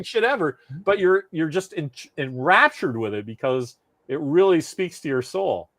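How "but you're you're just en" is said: usual speaking voice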